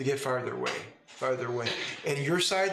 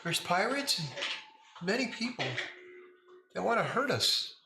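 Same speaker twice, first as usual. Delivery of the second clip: put-on high little girl voice, worried; a small child fretting about danger; child form; 8 semitones higher than usual. Child Lila complaining to the player about the town, high voice. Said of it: "in child form".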